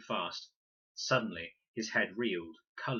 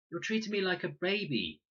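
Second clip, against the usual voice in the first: put-on protesting boy; 10 semitones higher, 4 dB louder than usual. A narrator's reading. Fred protests to his father.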